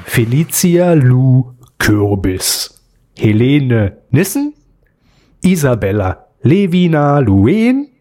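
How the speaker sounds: slow, crisp dictation of names; over enunciating. Shown as a demonstration. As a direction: say each name slowly for note-taking. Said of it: "Nochmal zum Mitschreiben".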